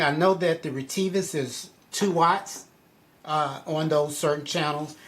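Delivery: ordinary talk, nothing marked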